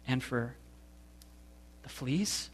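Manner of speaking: soft, rising disbelief on the fleas; can't believe what you just heard; incredulous. A narrator's reading. Corey hearing Betsy thank God for fleas, incredulous. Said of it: "This is too much".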